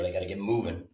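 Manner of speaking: ordinary delivery, nothing marked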